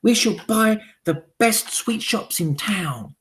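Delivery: high, loud eager hag voices; high witch chorus. Acted. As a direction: agree like fawning followers. Witches answer.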